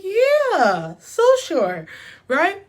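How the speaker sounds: high, loud, hammed-up "so sure"; a high, loud mock yes; mock overeager yes. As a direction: overplay your eagerness to agree. Mock overconfident answer to him.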